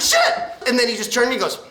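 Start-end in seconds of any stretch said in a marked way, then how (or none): 0.0-0.3 very high-pitched angry yelp; mock furious cop. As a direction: squeal the curse in comic rage. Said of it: "He was so fucking mad".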